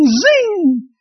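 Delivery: high, sharp imitated ricochet zing; a quick whistling zing like a passing bullet. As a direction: mimic a bullet whizzing by. Imitating bullets whizzing past.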